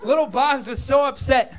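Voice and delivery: ordinary delivery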